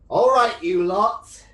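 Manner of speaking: loud, high British guard bark. Acted. Guard ordering prisoners off in a British accent. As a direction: shout it like a cockney prison guard. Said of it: "I don't know why it's a British accent".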